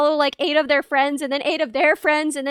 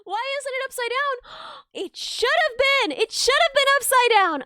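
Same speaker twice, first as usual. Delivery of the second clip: dismayed realisation; 7 semitones higher than usual. Real, not acted. Streamer gasping at her own art mistake. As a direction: realise the obvious too late.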